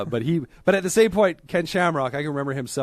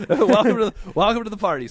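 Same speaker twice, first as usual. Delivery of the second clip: excited welcome; 7 semitones higher, 6 dB louder than usual. Real, not acted. Welcoming loudly, laughing.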